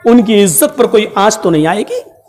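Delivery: ordinary talk, nothing marked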